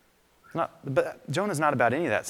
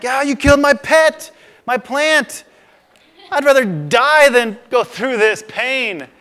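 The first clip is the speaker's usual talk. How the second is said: much higher and louder, whining, the last line slow; sulking Jonah; 12 semitones higher, 10 dB louder, slower than usual. Retold or imitated. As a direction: wail over the dead plant like a spoiled child. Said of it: "Jonah's like".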